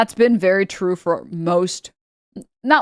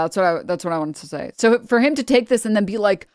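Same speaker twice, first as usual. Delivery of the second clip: normal talking voice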